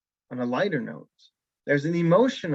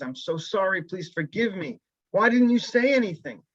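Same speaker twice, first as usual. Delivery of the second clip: pleading apology; 6 semitones higher, 3 dB louder than usual. Acted. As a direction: beg forgiveness, rising to a cry of why. Rabbi rushes back to apologize.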